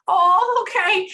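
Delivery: high, loud burst of recognition; loud realization. Real, not acted. Medium reacts to the grandmother being confirmed, high loud exclamation. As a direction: voice leaps up as it clicks.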